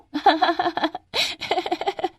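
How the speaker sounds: long, high jeering giggles; acted derisive cackle; mocking laughter. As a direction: laugh at her in high bursts. Class laughs at Radha.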